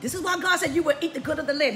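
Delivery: normal talking voice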